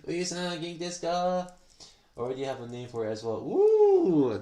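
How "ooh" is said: high, loud squeal of surprise; delighted ooh. Real, not acted. Streamer hearing a cool name for the model, thrilled ooh. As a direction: jump way up in pitch with a loud ooh.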